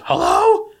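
very high questioning hello; very high puzzled receptionist. Retold or imitated. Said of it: "And she goes".

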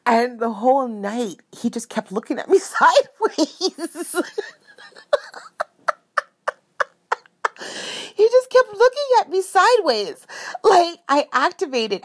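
high giggling voice; laughing. Real, not acted. Describing dad's sideways look, laughing. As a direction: laugh as you say it.

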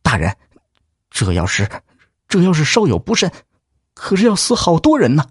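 anxious. Acted. Clerk hesitantly objects.

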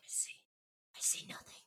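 hushed mock-eerie voice; spooky whisper. Aldrea seeing only blackness, mock spooky whisper. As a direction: breathe it out in a whisper. Acts Aldrea lost in darkness.

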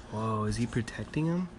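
plain reading voice

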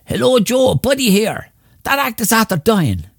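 very high-pitched imitation of a club owner; Buddy. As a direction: put on a high voice for the phone call. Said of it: "And he says to Joe".

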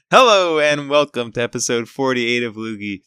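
upbeat host voice, opening the show; podcast intro. Acted. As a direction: announce the episode like a radio host.

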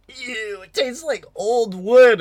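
high, loud mock disgust; imitating disgust at old scotch. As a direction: pull a face at the taste. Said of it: "it's like".

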